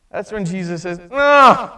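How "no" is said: louder, higher cut-off no; sharp no. Acted. Jesus cutting Peter off, loud firm no. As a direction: stop him short with one firm word.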